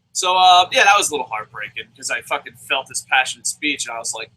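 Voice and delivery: ordinary delivery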